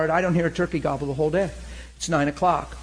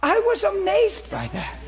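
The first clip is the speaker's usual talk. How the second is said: much higher and slower, wonderstruck; more amazed. Real, not acted. Preacher repeats his amazement to congregation, slow and high. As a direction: let the wonder stretch out.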